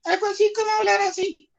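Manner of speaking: high-pitched voice. Shown as a demonstration. Teacher demos raising the tone of voice, falsetto. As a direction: jump way up into a thin falsetto. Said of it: "Esa es una voz un poquito más aguda".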